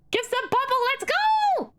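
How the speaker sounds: high squealing cheer; hoarse sleep deprived hype. Real, not acted. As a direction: cheer it out, thrilled.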